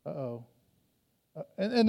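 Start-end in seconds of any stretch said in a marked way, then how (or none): 0.1-0.5 soft, under-the-breath uh-oh; hushed uh-oh. Retold or imitated. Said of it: "But then you're like".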